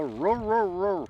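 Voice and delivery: high, loud playful woof back at the dog; teasing imitation barking; mock dog bark.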